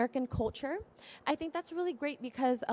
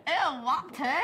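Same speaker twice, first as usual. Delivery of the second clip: imitating British accent; 3 semitones higher, 3 dB louder, slower than usual. Shown as a demonstration. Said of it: "Did I say it right?".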